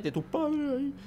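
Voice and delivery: high, strained half-voice yell; mock yell. Gamer mimicking his own outburst, mock yell. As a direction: imitate a scream without the volume.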